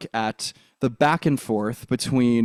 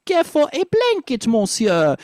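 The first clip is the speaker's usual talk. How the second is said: "blanket" lifted high in a French accent; 11 semitones higher than usual. Shown as a demonstration. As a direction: lift the line up high, French style. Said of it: "So it'd be".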